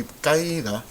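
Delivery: caída glided into KAI-da, two syllables; diphthong mispronunciation. Shown as a demonstration. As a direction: rhyme caída with baile, no break between a and i. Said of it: "but we don't want caída".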